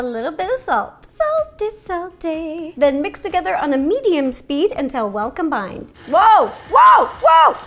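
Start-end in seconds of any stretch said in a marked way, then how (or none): 1.1-2.7 playful singsong. Pretend. Playful singsong while adding salt. 6.1-7.5 loud, high startled whoops; excited whoas. Real, not acted. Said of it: "I got a little excited".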